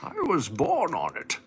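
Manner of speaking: high, slow villain monologue; menacing Bane speech, slowly. Acted. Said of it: "Now you've morphed from a, into a weird".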